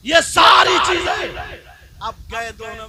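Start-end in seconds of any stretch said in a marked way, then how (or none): 0.1-1.2 high, loud outburst; sudden shout. Real, not acted. Preacher erupts at the crowd, loud shout. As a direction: burst out in disgust.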